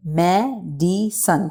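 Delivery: Medicine slowly as me, di, sun; three spaced beats on medicine; broken into syllables. Shown as a demonstration. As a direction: pause after each syllable of medicine. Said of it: "इसको pronunciate कर लेते हैं".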